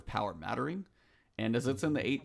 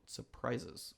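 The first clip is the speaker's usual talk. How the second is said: air-quote sarcasm; 10 dB quieter than usual. Retold or imitated.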